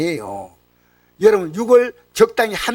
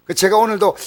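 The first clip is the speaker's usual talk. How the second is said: ordinary talk, nothing marked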